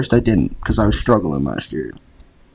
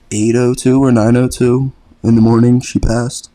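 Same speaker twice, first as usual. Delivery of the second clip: ordinary talk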